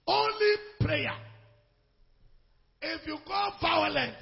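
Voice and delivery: slow, loud and high cry; preacher shouting; shouted preaching. Real, not acted. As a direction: hammer the point home. Shouted sermon point.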